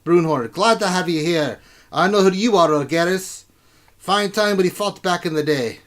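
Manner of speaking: loud, high, blustering dwarf king. Acted. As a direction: greet newcomers as a boisterous dwarven ruler.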